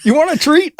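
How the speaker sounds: high cooing, laughing; mock pet voice. Retelling the neighbor's dog talk, high and mock cooing. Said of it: "recap of the story".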